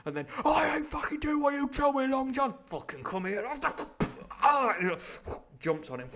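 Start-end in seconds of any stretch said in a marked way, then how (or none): 0.5-3.5 high, loud, sweary outburst; hammed-up brawling yell; mock furious shout. Imagined clash with Long John, mock fury. 4.4-4.8 high, loud yell; sudden mock lunge cry; attacking cry. Pirate jumping on his victim, mock yell.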